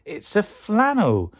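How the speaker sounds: high, drawn-out answer; high eager veggie voice; excited guess. Acted. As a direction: announce it slowly and triumphantly, pitched high. Cucumber shouts out the answer.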